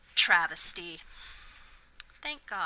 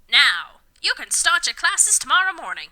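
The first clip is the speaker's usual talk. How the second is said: loud, higher pompous declaration; authoritative announcement; 5 semitones higher, 6 dB louder than usual. Acted. Dumbledore announcing to Harry, loud and authoritative. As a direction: announce it like a headmaster. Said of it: "announced authoritatively".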